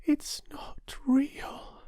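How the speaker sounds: soft, slow whisper; whisper to herself. Acted. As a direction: realise it quietly and sadly. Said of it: "she whispered to herself".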